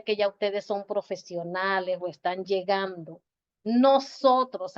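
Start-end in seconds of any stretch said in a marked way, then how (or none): 3.6-4.8 "nosotros" drawn out and stressed; slow emphatic "nosotros". Shown as a demonstration. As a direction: lean on "nosotros". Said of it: "hablando en plural".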